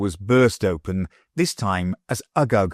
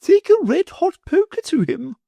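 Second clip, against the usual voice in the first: high, sugary lady's voice; gushing wife, pitched way up; greedy wife in a high fluting voice; the Wardeness; 4 dB louder than usual. A narrator's reading.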